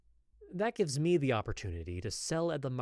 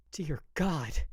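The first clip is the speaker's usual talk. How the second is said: loud, flushed outburst; flustered exclamation; 4 semitones higher, 5 dB louder than usual. A narrator's reading. Apothecary after her fingers brush his, flustered cry. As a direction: blurt it out, overwhelmed.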